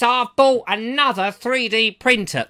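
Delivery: a friend's voice, hammed up and slow; imitating Martin. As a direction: mimic his drawn-out way of talking. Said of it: "'cause he talks like that".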